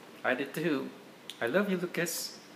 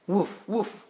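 a higher, lightly voiced woof woof; playful puppy bark; 6 semitones higher than usual. Dad reading to his kids, lightly barking as the puppy.

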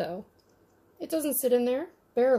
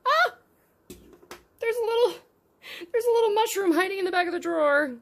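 high, excited squeal of discovery; delighted discovery; 10 semitones higher, 4 dB louder than usual. Real, not acted. Collector spotting a hidden mushroom in a miniature drawer, high delighted gush. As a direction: gush over a tiny hidden find, pitched way up.

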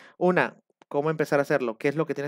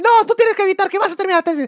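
mock coach yelling; 14 semitones higher, 6 dB louder than usual. Coach yelling at a student to break him down, mock shout. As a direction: bark insults like a cult coach.

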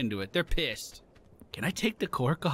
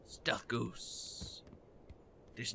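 hushed stealth whisper; whispered sneaky self-narration; 7 semitones lower, 15 dB quieter, much slower than usual. Pretend. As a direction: breathe it like a spy.

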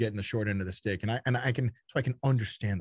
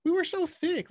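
emphatic insistence; 4 dB louder than usual. Real, not acted. Emphatic repetition, high and loud.

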